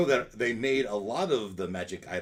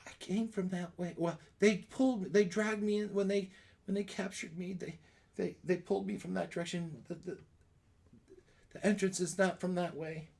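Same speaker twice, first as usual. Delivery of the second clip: Lulu; 9 semitones higher than usual. Acted. Game master as captured character telling the party the way, high-voiced. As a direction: recount it haltingly in a raised, light pitch. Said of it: "unless Lulu tells us".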